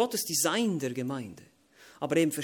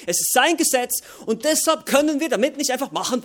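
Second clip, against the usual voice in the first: loud, higher, building preaching; rising sermon litany; 7 semitones higher, 8 dB louder than usual. Acted. Preacher listing everything that belongs to God, loud and building. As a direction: drive the list home line by line.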